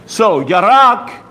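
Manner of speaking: yarak drawn out slowly, both syllables clear; slow teaching word. Shown as a demonstration. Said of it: "teaching the word yarak".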